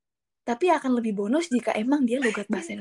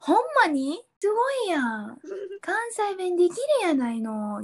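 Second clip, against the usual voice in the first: higher, excited Kansai voice; excited Kansai speech from a foreigner; Kansai dialect; 7 semitones higher, slower than usual. Shown as a demonstration. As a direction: gush in Kansai dialect like a local. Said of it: "aku orang Indonesia ngomong".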